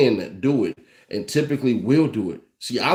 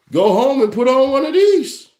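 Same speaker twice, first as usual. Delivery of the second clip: mock excited; 10 semitones higher, 6 dB louder than usual. Mock advice to sperm donors.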